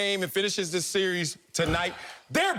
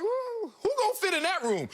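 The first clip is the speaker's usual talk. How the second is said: high, squeaky disbelief; incredulous; 11 semitones higher than usual. Retold or imitated. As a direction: sound baffled at the tiny rooms. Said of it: "you're like".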